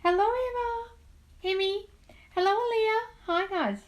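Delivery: high-pitched welcome to arriving viewers; singsong greeting. Real, not acted. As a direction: greet each one in a high sing-out. Greeting viewers by name on live.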